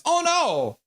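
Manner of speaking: high, exclaimed dismay; dismayed cry. Real, not acted. Streamer hearing a viewer has hurt his back, dismayed cry. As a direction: cry out in sudden alarm for them.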